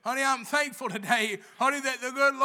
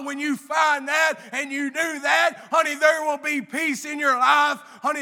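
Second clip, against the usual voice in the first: louder, stretched-out shouting sermon cadence; preaching at full force; 3 semitones higher, 6 dB louder than usual. Acted. Speaker urging the church to find its calling, full-force preaching. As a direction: build and hammer each line to the pews.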